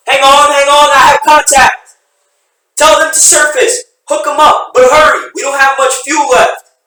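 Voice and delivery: frantic yell over rotor noise; urgent shouting. Acted. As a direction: shout urgently against the wind. Urgent rescue calls over rotors.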